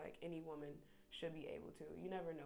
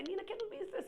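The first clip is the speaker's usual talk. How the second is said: much higher, put-on go-between voice; mock translator voice; 14 semitones higher, 4 dB louder than usual. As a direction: play the neutral interpreter in a squeaky voice. Imagined translator relays back.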